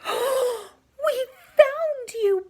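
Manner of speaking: high, loud, slow cry of joy with a laugh; laughing, almost crying with relief; joyful exclaim. Acted. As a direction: burst out with tearful delight. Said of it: "Big Billy exclaimed".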